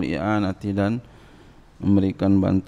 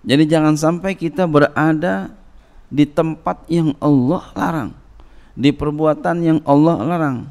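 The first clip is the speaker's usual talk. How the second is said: louder and higher with each forbidden thing, driving it home; forceful preaching; 7 semitones higher, 6 dB louder than usual. Acted. Preacher warning the congregation away from forbidden places, full force. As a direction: build each line like a warning from the pulpit.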